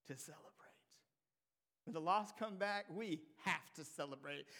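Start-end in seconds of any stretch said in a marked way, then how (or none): 0.0-0.5 quiet fatherly comfort; tender father. Acted. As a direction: gently soothe the angry son. Father's gentle reply.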